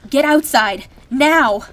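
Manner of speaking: loud commanding yell; urgent shout. Acted. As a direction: bark the order to get out. Ordering escape from fire.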